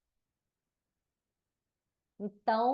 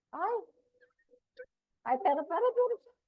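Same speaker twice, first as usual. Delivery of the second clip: high, louder sudden "ai, pera"; real startled cry; 11 semitones higher, 5 dB louder than usual. Real, not acted. Teacher interrupted mid-class, startled and high. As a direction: caught off guard, stopping everything.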